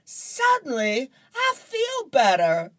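put-on a kid suddenly cured by money; miraculously cured Curtis. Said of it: "runs out the house and says".

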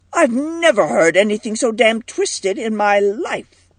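mild shout, pitch well up. A narrator's reading. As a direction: exclaim in angry disbelief. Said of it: "shouted Marky".

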